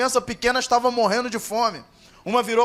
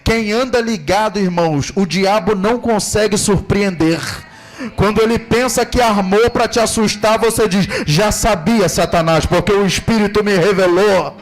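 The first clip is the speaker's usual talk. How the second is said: preaching louder and louder, stretching key words; fiery preaching; 6 dB louder than usual. Real, not acted. Pastor rousing the congregation to stay alert, shouting sermon. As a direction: drive it home to the church like a revival preacher. Preacher builds to full force.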